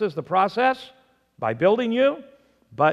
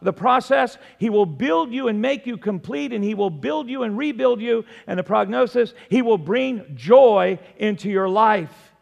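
higher, louder, emphatic list of points; full-force preaching; 5 semitones higher, 6 dB louder than usual. Real, not acted. Preacher summing up provision, promise, process and prognosis, full-force preaching. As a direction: drive each point home like a preacher.

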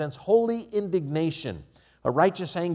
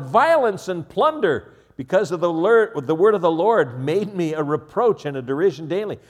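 shouting; 4 semitones higher, 7 dB louder than usual. Retold or imitated. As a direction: cry it out in despair. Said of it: "I shouted".

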